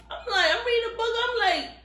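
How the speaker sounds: high, fast, laughing through the words; laughing disbelief. Real, not acted. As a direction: crack up in disbelief. Reacting to the book, laughing.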